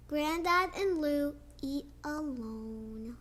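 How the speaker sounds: ordinary delivery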